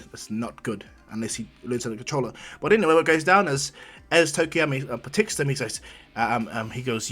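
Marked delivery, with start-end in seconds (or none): none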